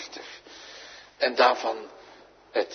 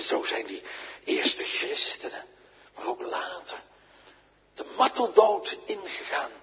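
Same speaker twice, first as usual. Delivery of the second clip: ordinary talk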